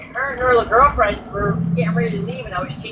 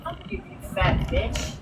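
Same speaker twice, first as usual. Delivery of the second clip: much softer, muttered comeback; quiet repeat; 7 dB quieter than usual. Retold or imitated. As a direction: echo the threat under your breath. Repeats her comeback to herself afterwards.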